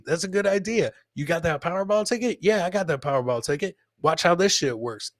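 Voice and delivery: usual speaking voice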